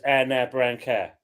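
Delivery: Cockney How Now Brown Cow, vowels flattened to "eh", slow; How Now Brown Cow in Cockney, slowly; Cockney accent. Shown as a demonstration. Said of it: "us Cockneys".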